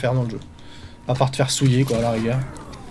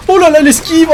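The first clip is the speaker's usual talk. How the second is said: high, loud thrilled cry; excited shout; 12 semitones higher, 8 dB louder than usual. Real, not acted. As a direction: cheer your own dodge. Esquive réussie en combat. (cri excité et aigu)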